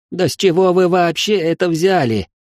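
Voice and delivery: выше, в полном отчаянии; простонать, будто уже сдался; отчаянный стон. A narrator's reading. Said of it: "простонал графёныш в полном отчаянии". (графёныш в отчаянии спорит с Громилой, выше)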